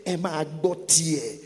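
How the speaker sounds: slow, stern command; firm, drawn-out order; severe command. Acted. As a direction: declare it with measured severity. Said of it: "the integrity and the severity of saying".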